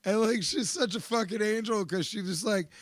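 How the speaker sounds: ordinary delivery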